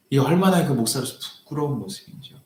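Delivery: ordinary talk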